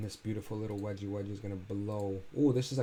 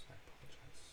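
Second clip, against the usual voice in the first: whisper; 3 semitones higher, 17 dB quieter than usual. Pretend. Repeats apology in mock whisper.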